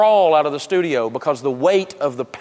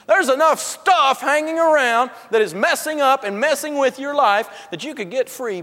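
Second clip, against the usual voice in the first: higher, shouting sales pitch energy; fired-up exhortation; 6 semitones higher than usual. Real, not acted. Rising fired-up preaching.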